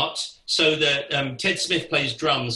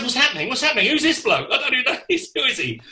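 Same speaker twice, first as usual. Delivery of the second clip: flustered; 10 semitones higher, 3 dB louder, much faster than usual. Retold or imitated. Panicked stage manager runs on.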